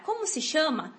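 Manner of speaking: normal talking voice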